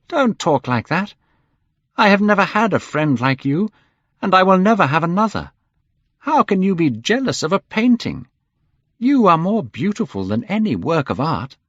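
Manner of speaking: high, loud urgent plea; pleading. A narrator's reading. Hallward pleading with Dorian, high and loud. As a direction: beg him to stop. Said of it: "he cried".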